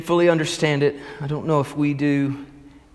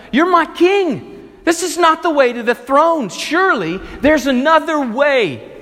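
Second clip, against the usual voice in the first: higher, louder, pleading disbelief; Peter protesting; 9 semitones higher, 4 dB louder than usual. Retold or imitated. Peter grabs Jesus' arm, refusing his suffering: high loud desperate protest. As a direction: cry out to stop him in desperate denial.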